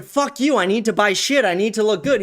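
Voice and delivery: ordinary talk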